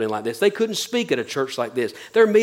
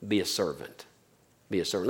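hushed emphasis; 9 semitones lower, 11 dB quieter than usual. Acted. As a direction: drop to a near-hushed, solemn command. Hushed repeated closing command.

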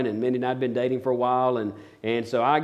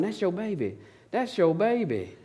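high, softer sing-out of an excited friend; imitated proud lady; 6 semitones higher, 5 dB quieter than usual. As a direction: lightly mimic a woman gushing to the mother. Excited lady turning round, retold.

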